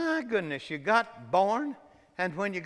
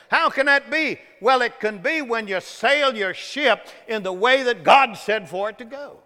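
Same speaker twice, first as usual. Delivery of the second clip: loud, high-pitched sermon delivery; full-force preaching; 6 semitones higher, 7 dB louder than usual. Acted. Preacher driving home a point to the congregation, full-force preaching.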